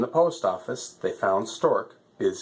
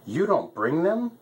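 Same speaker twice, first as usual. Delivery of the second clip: crying out; 9 semitones higher than usual. A narrator's reading. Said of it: "cried Lion".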